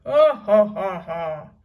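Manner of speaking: loud, wild pirate cackle; horrible laugh. A narrator's reading. Captain throwing back his head, horrible loud laugh. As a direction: laugh like a madman. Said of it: "laughed horribly".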